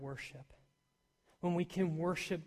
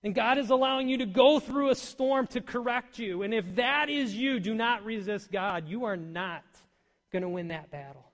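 forceful preaching; 5 semitones higher, 9 dB louder than usual. Acted.

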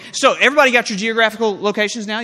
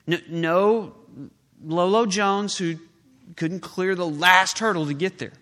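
usual speaking voice

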